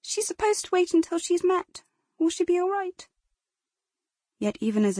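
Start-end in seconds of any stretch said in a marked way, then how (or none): 0.0-3.1 high, pleading question through tears; tearful. Acted. Girl asks bystanders about her dying sister, high tearful pleading. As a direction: beg for reassurance while crying. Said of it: "tears streaming down her wind-whipped cheeks".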